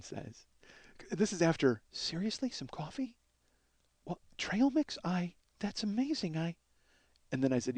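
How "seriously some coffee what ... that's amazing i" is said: hushed, near-whispered disbelief; meek, grateful murmur; awed timid imitation. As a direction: marvel very softly, haltingly. Imitating the grateful frail woman.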